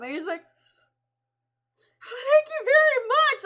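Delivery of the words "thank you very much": very high, loud, slow, teary thanks; overwhelmed with gratitude; choked-up thanks. Retold or imitated. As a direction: near tears, slow. Quoting the actor's grateful thanks.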